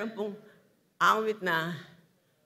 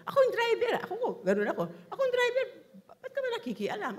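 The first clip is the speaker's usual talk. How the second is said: mock stubborn driver; 12 semitones higher, much faster than usual.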